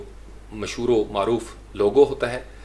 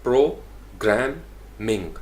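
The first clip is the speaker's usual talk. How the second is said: Programming slowly as pro... gram... ming; one sound at a time in programming; slow split of programming into three; syllable by syllable; much slower than usual. Shown as a demonstration. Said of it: "यानी pro एक sound".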